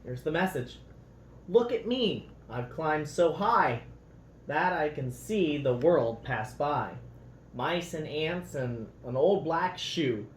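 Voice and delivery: lively, expressive storybook read-aloud; reading to children. A narrator's reading. Parent reading a picture book to children, lively storybook voice.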